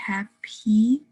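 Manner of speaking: "happy" split at a firmly released P; P sound shown. Shown as a demonstration. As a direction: close the lips, then release the P in "happy". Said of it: "close your lips for the P sound".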